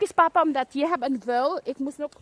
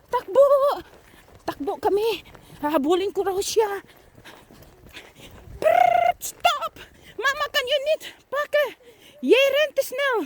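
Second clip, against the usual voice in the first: playful chase calling; 10 semitones higher, 4 dB louder than usual. Pretend. Chase game continues.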